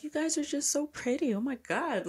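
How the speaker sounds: high, soft gushing voice; waitress gushing over a pretty couple, soft and high. Retold or imitated. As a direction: coo it like a sweet waitress. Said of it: "was just like".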